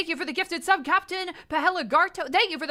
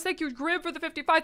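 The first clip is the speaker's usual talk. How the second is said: ordinary talk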